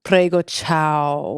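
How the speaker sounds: slow, hammed-up Italian prego ciao; Italian accent. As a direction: stretch it out like a proud Italian. Pretends to have forgotten English after Italy.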